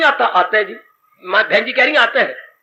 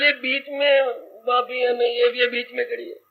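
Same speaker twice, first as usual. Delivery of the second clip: hushed confiding; 7 dB quieter than usual. Acted. Lakshman confides to Ram.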